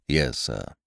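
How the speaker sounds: a hint of a low, soft, deferential valet; lightly voiced butler, quiet and proper; Jeeves the butler. A narrator's reading. Butler answering his master, low and deferential.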